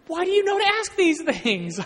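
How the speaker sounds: high, loud comic wail; mock crying wail. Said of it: "I started crying in the car".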